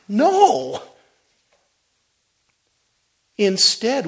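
high, loud fiery sermon delivery; full-force preaching. Acted. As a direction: preach it out, building to a roar.

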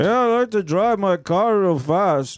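slow, put-on Russian accent; a sloppy Russian accent, slow; mock Russian accent. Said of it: "you get it kind of Mexican".

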